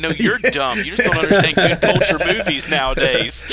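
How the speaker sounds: hammed-up telling-off for laughs; mock-indignant comeback. Acted comeback to kids in story.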